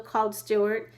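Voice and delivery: plain reading voice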